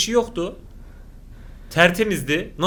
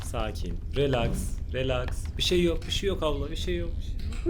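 self-soothing murmur; 5 semitones lower, 8 dB quieter than usual. Real, not acted. Soothing himself and on-screen woman.

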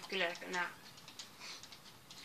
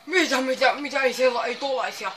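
loud, higher rapid what what; flustered shout; 3 semitones higher, 13 dB louder than usual. Real, not acted. Gamer flustered and shouting at a surprise. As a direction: blurt it all out in a panic. Panicked exclamations in game.

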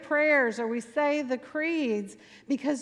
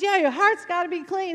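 higher, louder preaching call; riled-up preacher; 7 semitones higher, 5 dB louder than usual. Retold or imitated. Preacher to his cheering church, fired-up call. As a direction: imitate a preacher in full rhythm. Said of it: "he would get into this kind of rhythm".

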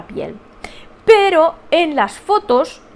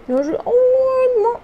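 high, slow, whiny little cry; mock whimper; 11 semitones higher, much slower than usual. Real, not acted. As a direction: whimper playfully. Cute crying exclamation.

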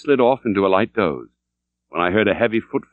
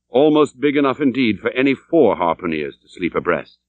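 plain reading voice

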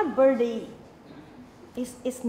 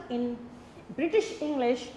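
ordinary delivery

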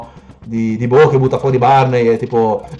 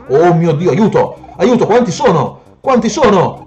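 high, loud cry for help; rising gamer panic; panicked cries; 6 semitones higher, 4 dB louder than usual. Real, not acted. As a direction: exclaim as if overrun. Overwhelmed by enemies, calls help.

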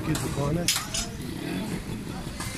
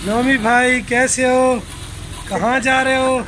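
bright, much higher teasing greeting to a friend; cheerful call-out; 8 semitones higher, slower than usual. Real, not acted. Vlogger teasing his friend Nomi at the shop, high playful call.

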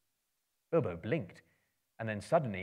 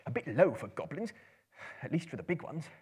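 Bilbo's thought; 5 semitones higher than usual. A narrator's reading. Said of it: "thought Bilbo".

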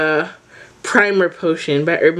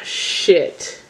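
ordinary talk

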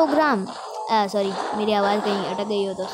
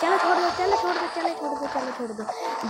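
resigned giving up; 5 semitones higher than usual. Real, not acted. Giving up, repeating it.

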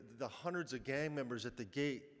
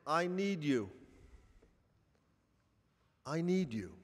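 pleading cry, raised a little and held in check; 4 dB louder than usual. Retold or imitated. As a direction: imitate an earnest call, louder, falling at the end. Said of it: "he is crying out to us".

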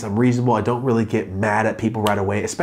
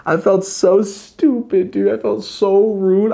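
embarrassed whine; 10 semitones higher, 5 dB louder, slower than usual. Real, not acted.

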